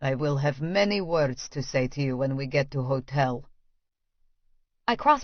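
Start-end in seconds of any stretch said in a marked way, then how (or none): 0.1-3.4 low, quiet Russian man. Acted. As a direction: keep it low and hushed between you. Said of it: "says in a low voice".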